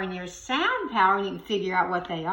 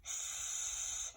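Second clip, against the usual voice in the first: drawn-out s sound; 17 dB quieter than usual. Shown as a demonstration. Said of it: "What does it begin with?".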